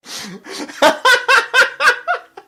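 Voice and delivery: high, loud burst of cackling; mocking laughter. A narrator's reading. Husband laughing at his angry wife, loud cackle. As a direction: laugh hard at her expense. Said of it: "He's laughing at me".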